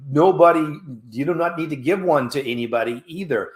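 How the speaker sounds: ordinary talk, nothing marked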